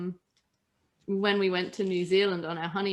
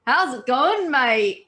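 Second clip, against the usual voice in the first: imitated broad, matey Australian, higher and louder; slangy casual Australian; 4 semitones higher, 7 dB louder, slower than usual. Imitating dad greeting police at breathalyzer stop, slangy Australian. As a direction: lay on super-slangy Aussie, loud and chummy. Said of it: "really super slangy, casual Australian".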